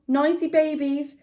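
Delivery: plain reading voice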